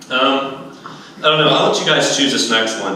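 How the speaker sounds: ordinary talk, nothing marked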